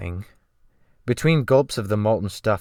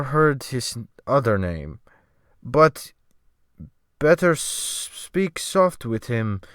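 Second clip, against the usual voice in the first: put-on slow, methodical sailor with a Scandinavian accent; slow Scandinavian English; 2 semitones higher, slower than usual. The sailor answering the newcomer, slow with a Scandinavian accent. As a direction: grope for each English word slowly. Sailor warns about the captain.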